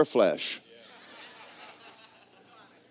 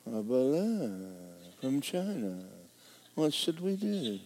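mock worry; 8 dB quieter, slower than usual. Mocking worried officials.

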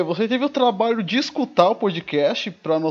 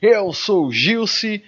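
big, slow podcast host opener; announcer intro; 5 semitones higher, 9 dB louder, much slower than usual. Acted. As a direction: announce the show loudly, stretched out. Podcast opening.

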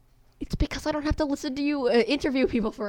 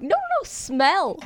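insisting; 7 semitones higher, slower than usual. Retold or imitated. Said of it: "He was like".

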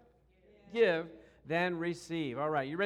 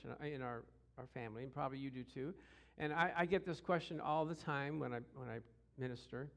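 normal talking voice

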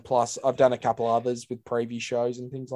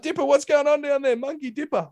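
studio host calling down; 13 semitones higher than usual. Retold or imitated. Host calling down to Dipper on the boundary, high mock voice. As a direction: call down to the boundary, hammed up. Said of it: "Like Dipper was back in the day".

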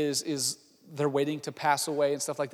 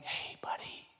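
soft parent's whisper; hushed and tender; gentle wake-up whisper; 16 dB quieter than usual. Acted. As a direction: whisper to rouse a sleeping kid. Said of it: "how you wake up your kids in the morning".

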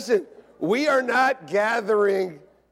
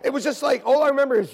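usual speaking voice

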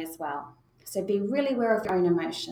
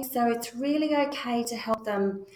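ordinary talk

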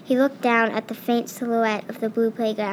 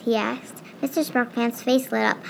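ordinary delivery

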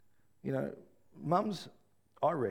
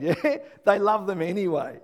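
normal talking voice

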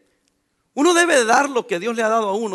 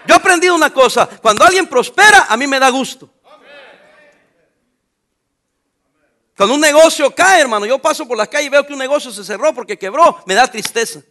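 sermón a pleno pulmón, más alto; predicación fervorosa; 4 semitones higher, 8 dB louder than usual. Acted. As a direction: predica con fuerza a la congregación. Preaching at full force. (predicando con fuerza)

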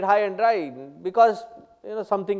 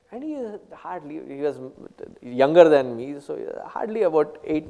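usual speaking voice

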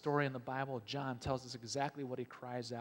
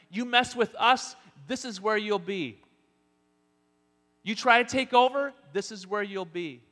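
menacing Roman warning; 7 semitones higher, 12 dB louder than usual. Acted. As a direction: bark it as a ruthless Roman soldier. Preacher voicing Roman warning.

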